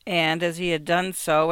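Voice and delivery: ordinary delivery, nothing marked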